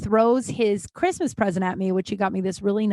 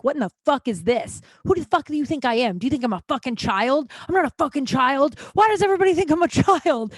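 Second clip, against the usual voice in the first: building, higher mock outrage; retold angry tirade; 6 semitones higher than usual. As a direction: rant faster and higher toward the end, imitating his fury. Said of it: "so many fucks".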